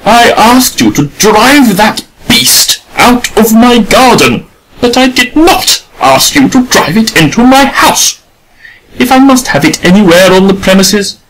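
an offended gentlewoman scolding the artist; indignant lady. A narrator's reading. Adela voiced high.

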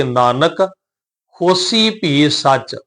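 Nanak lightly lifted, a pause, then slow ਹੋਸੀ ਭੀ ਸੱਚ; short rest after Nanak. Shown as a demonstration. Teacher shows the small rest, Nanak, pause, slow ਹੋਸੀ ਭੀ ਸੱਚ. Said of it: "ਫਿਰ ਉਚਾਰਨ ਕਰਾਂਗੇ".

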